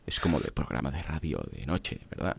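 low, soft late-night DJ; late-night radio voice. Shown as a demonstration. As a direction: croon like a night radio host. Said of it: "Cuando tienes la voz cascada".

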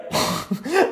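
breaks into a high laugh; short amused laugh. Real, not acted. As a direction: laugh at how absurd it is. Laughing at client's wasted money.

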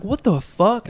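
put-on angry driver yelling at the old man; retold yell. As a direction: shout it like you nearly got hit. Retelling road rage yell.

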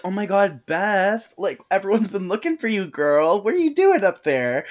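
excited high voice. Retold or imitated. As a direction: gush with teen girl excitement. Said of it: "they're like".

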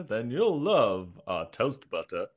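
mock enthusiastic ad voice; cheesy American voiceover. Joking co-host pitches toast butter, cheesy American voiceover. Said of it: "The cheesy American ones".

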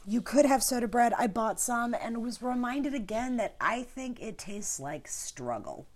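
ordinary talk

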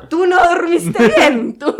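mock disbelief, half laughing; indignant retort. Wife throws her husband's complaint back at him, laughing. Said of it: "es así como".

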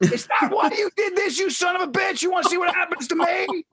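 very high-pitched hysterical accusation; mock outrage. Friend accusing him on the drive, mock outrage. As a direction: imitate an outraged, panicking friend.

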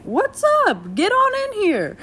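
high-pitched goofy welcome. Acted. Mother jokes she'd greet even the janitor, goofy high welcome. Jokey acted welcome to anyone.

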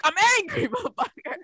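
high-pitched comic fury, breaking into laughter; mock angry outburst. Lost for words at the kid, comically angry. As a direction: blurt it out in helpless, hammed-up anger. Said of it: "I couldn't know what to say".

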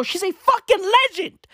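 gushing about the anchor; excited praise. Real, not acted. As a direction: hype her up. Repeated for emphasis.

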